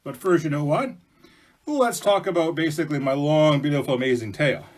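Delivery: loud, self-satisfied bear; boastful bear. Acted. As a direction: boast loudly, stretching the opening words. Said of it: "And Bear goes".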